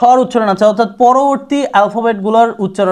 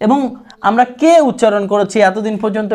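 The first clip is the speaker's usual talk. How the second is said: usual speaking voice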